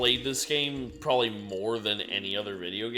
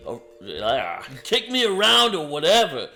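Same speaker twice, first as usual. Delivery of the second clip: high, loud defiant yell; defiant shout; 8 semitones higher, 8 dB louder than usual. Acted. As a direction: refuse at the top of your voice. Louis defies interrogator.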